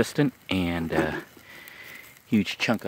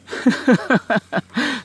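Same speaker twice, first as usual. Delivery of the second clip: sudden high laugh; high burst of laughter; 7 dB louder than usual. Real, not acted. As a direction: laugh out at your own joke. Laughs at own joke.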